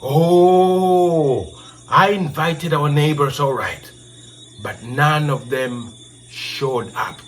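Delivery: younger brother's reply. Acted. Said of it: "And the brother told him".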